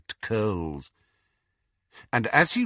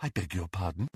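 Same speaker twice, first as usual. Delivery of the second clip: quiet ghostly woman; 5 semitones lower, 10 dB quieter, faster than usual. Acted. Grey Lady speaks, voiced quietly.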